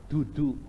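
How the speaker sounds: "dudu" with heavy Javanese D sounds; Javanese dal. Retold or imitated. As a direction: say "dudu" with a thick Javanese tongue. ("dudu" dengan dal medok Jawa)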